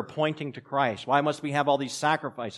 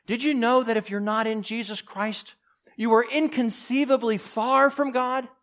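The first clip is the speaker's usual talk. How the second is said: preaching at full force; 8 semitones higher than usual. Acted. Urgent appeal to congregation.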